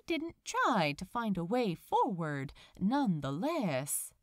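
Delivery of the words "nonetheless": plain reading voice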